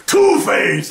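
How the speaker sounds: sudden high, loud yell; jump-scare someone from behind; mock scare shout; spooky shout. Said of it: "Batman goes behind him and says".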